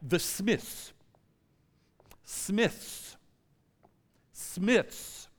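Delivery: put-on French-accented roll call; mock French clerk's drawn-out announcement; French clerk calling names. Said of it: "she's calling out these names".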